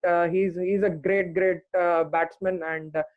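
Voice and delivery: usual speaking voice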